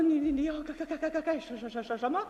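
acted frightened stuttering, syllables repeated; scared stammer. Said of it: "你瞧这怕劲儿".

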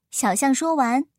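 ordinary delivery, nothing marked

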